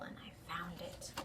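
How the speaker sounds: soft whispered confession; secretive whisper. Acted. Child telling her friend about the coin, whispered. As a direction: let it slip out in a whisper.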